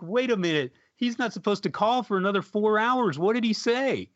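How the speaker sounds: high-pitched, urgent disbelief, acted out; startled retelling. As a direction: replay your startled reaction, voice climbing high. Retelling his startled reply on phone.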